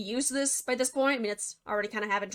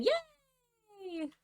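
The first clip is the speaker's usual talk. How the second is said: mock cheer; 11 semitones higher, 6 dB quieter than usual. Mock cheer after pig eaten.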